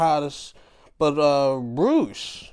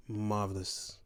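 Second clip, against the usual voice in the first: soft murmur; 5 semitones lower, 10 dB quieter than usual. Real, not acted.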